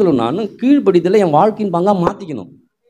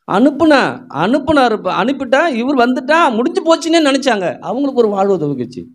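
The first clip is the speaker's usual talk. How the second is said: fervent preaching; 7 semitones higher than usual. Acted. Excited sermon peak.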